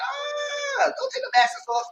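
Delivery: high yelling warning; retold yell. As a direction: yell at them to stop. Warning friends to keep masks.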